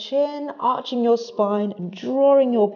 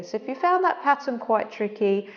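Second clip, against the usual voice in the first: normal talking voice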